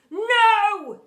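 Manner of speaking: huge high booming shout. A narrator's reading. Teacher answers the teasing pupil, booming. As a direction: boom it out at full force. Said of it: "He boomed".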